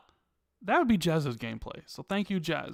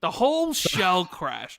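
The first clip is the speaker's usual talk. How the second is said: amused mock echo; slower than usual. Amused mocking echo of co-host.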